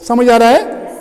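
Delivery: usual speaking voice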